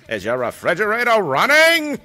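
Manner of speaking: put-on high, loud old-time radio announcer; 1950s radio voice. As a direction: ham it up like a 1950s radio host. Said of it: "in, like, a nineteen fifties, uh, radio voice".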